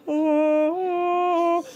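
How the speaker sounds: high, sustained choral note; a long held "oh" like a tinny choir; imitated crackling voices. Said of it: "crackling sound of voices going".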